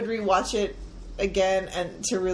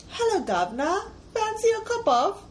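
higher-pitched proper British attempt; proper British accent; 10 semitones higher than usual. Shown as a demonstration. Co-host copying the host's sample, proper British accent. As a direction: copy a proper British accent, lifting the end. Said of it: "a more proper British accent".

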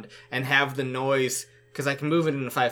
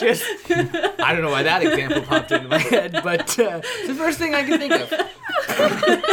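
high laughing through the words; 6 dB louder than usual. Real, not acted. Player cracking up at his own eyebrow joke, laughing. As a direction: crack up while explaining.

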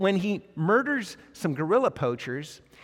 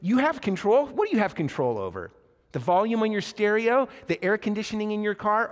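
incredulous questions, pitched up; mocking challenge; 6 semitones higher than usual. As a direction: challenge the answer like a taunting interrogator. [name] mocks Theo's answer.

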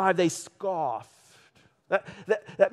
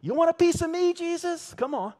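high-pitched, taunting street challenge; hammed-up verbal aggression, pitched way up; back-alley tough; 10 semitones higher, faster than usual. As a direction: pick a fight like an alley thug. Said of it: "Think back alley".

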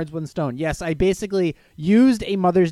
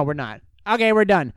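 ordinary delivery

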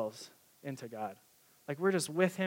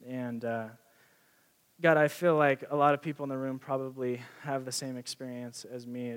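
gentle closing prayer with pauses; 2 semitones lower than usual. Acted. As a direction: pray humbly for the room. Leads the room in prayer.

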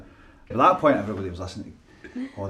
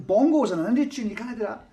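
mock argument; 11 semitones higher than usual. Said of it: "So we had this big argument".

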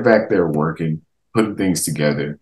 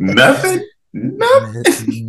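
mock disbelief; much slower than usual. Mock outraged reply to troll.

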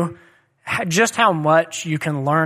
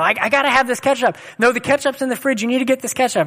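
put-on higher, fast, a little girl insisting; whiny demanding child; 6 semitones higher, faster than usual. Daughter freaking out over ketchup at dinner, higher, fast and whiny. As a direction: play a small daughter fussing, lightly voiced. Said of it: "one of my daughters is just freaking out".

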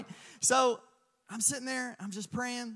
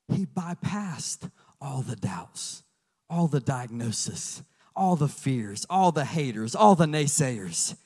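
slow, low, rising emphasis on all; building sermon; 5 semitones lower, 4 dB louder, slower than usual. Acted. Preacher listing what Jesus bypassed, slow building.

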